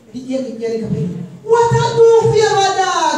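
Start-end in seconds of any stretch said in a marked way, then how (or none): 1.4-3.2 high, loud, fast retort; mock indignant outburst. Woman's dog story punchline, high loud mock indignation. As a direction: fire it out loud in mock outrage. Acted quarrel over the dog.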